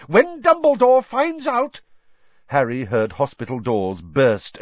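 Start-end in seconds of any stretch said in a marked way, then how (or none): 0.1-1.8 high, loud angry yell. Acted. McGonagall yelling at Fudge about the Dementor. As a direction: yell it at the Minister. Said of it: "yelled Professor McGonagall".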